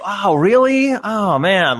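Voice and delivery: high, loud fake wow; overplayed sarcastic surprise; mock amazement. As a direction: ham up being unimpressed. Mock reaction to a flat scene.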